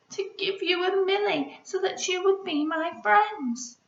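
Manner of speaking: high, small tearful voice, lightly acted; a little one explaining through sniffs; sniffling. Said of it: "sniffed Pip".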